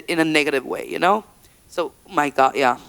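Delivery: ordinary delivery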